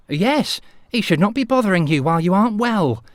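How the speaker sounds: landlady voice. A narrator's reading. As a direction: scold like a fussing housekeeper.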